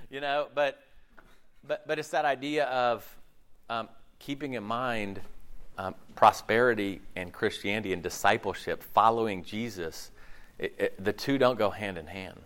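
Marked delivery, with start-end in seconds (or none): none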